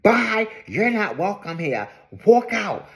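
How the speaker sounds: hammed-up bossy send-off; overdramatic dismissal. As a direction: imitate someone making a scene as they throw a guest out. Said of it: "doing the most at this point".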